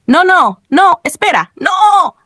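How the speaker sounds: high-pitched mounting alarm; panicked protest. A narrator's reading. Player about to die in game, panicked protest.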